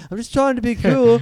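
high, loud, hapless would-be cool guy; Stephen Merchant. Acted. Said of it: "Now you're Stephen Merchant".

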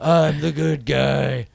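slow, put-on gruff voice; gruff hero voice. Mocking the cheesy movie, a slow gruff hero voice. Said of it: "Quit stealing my voice".

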